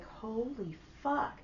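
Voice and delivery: slow, hushed, drawn-out swear; retold stunned awe. Realizing the son graduates in four years, hushed amazement. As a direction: stretch it out softly, as if stunned.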